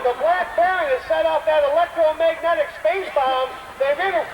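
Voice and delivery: high, hyped-up announcer call; excited commentary. Acted. Announcer tells the crowd the Baron struck, high and excited. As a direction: sell the drama of the attack. Narrating staged attack.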